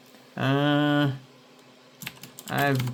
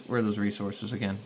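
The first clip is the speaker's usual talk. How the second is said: normal talking voice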